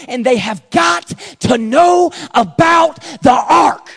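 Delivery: fiery preacher's shout; shouted preaching. Acted.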